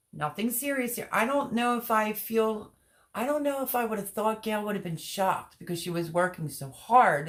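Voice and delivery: ordinary delivery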